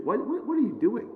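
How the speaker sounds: high, soft, stammered mock challenge; mock-bewildered jab, voice lifted but light; acted barb. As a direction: needle him with quick, high-pitched questioning. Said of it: "She's throwing barbs every single time".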